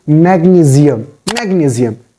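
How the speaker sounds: "magnesium" with a z for the s; corrected magnesium. Shown as a demonstration. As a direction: say "magnesium" as mag-nee-zee-um. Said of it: "you have to pronounce it".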